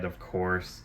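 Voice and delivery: normal talking voice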